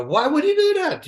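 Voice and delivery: retold fury, voice shooting up on the question; angry outburst. Fighter recalling his opponent's throat-slash taunt, outraged high-pitched question. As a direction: pitch jumps way up in indignant disbelief. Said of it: "You know, I got so angry".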